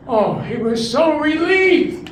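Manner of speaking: usual speaking voice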